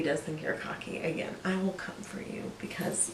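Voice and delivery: half-joking whispered threat; whispering. Real, not acted. Protective threat about critics.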